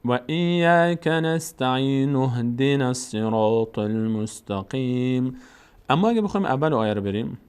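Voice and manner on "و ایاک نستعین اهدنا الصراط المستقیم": slow chant linking "نستعین" into "اهدنا" with a u; joined verses. Shown as a demonstration. Teacher shows joining the verses, slow linked recitation. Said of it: "اگر بخوایم بچسبونیم".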